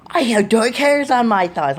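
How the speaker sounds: high, loud burst of thrilled discovery; really excited. Retold or imitated. Vlogger re-enacting spotting new hair on their thighs, really excited. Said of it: "I'm, like, really excited".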